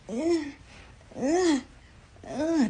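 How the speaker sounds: high strained whines; imitated baby squirming. Mother showing her one-year-old squirming, high strained grunts. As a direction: mimic a baby straining and fussing. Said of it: "he get the squirming".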